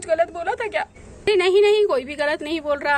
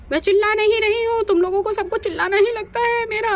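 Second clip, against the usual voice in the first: raised, higher, indignant denial; loud protest; 6 semitones higher, 4 dB louder than usual. Acted. Sister denies shouting at family, loud and high. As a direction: shout that you are not shouting. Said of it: "आप सब पे ही चिल्ला रही हैं।".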